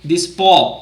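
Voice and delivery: usual speaking voice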